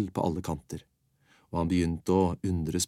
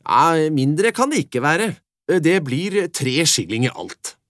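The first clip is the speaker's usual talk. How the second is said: slow, loud, higher stingy sheriff voice; 8 semitones higher, 9 dB louder, slower than usual. Acted. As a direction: dole it out slowly like a grudging official. Sheriff paying off the boy.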